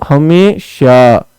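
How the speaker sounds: हमेशा drawn out very slowly; slow stretched word. Shown as a demonstration. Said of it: "हमें नाक में आवाज आ रही है". (हमेशा को धीरे-धीरे खींचकर)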